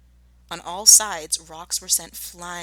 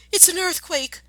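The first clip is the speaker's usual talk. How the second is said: much higher, louder panicked cry; alarmed cry; 12 semitones higher, 6 dB louder than usual. Acted. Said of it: "cried Missus Fox".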